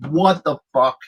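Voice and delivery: loud incredulous exclamation, retold; retold shock. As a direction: react stunned at a shocking sight. Retold shock at bloody friend.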